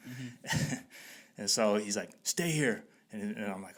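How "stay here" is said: higher-pitched homeowner's command; imitated stern order. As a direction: order them to stay put, imitating him.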